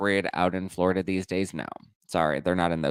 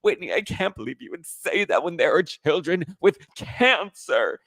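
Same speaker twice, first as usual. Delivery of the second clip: Meredith Marks parody; 9 semitones higher, 3 dB louder than usual. Retold or imitated. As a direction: play the dramatic housewife moralizing. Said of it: "And she's like".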